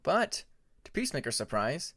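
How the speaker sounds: ordinary delivery, nothing marked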